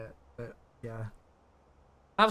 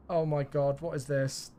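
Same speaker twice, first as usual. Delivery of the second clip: usual speaking voice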